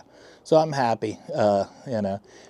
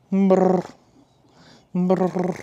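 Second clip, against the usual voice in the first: cat trill; 8 semitones higher than usual. Acted.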